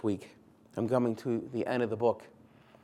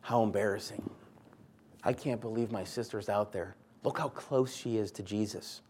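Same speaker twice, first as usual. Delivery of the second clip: softer, lower, embarrassed inner grumble; muttered embarrassment; 3 semitones lower, 7 dB quieter than usual. Retold or imitated. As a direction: mutter it under your breath, mortified. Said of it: "She's thinking about what Jesus is thinking".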